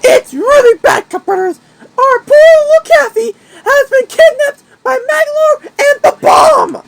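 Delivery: high, crying plea; frantic hamster on the phone to the Cup Brothers; begging hamster. Acted. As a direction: beg desperately for help. Wacky begs on the phone.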